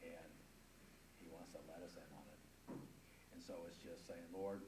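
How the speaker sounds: low, hushed and reverent; soft, humble testimony; solemn reflection. Real, not acted. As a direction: bring the voice right down for a heartfelt point. Solemn point closing the testimony.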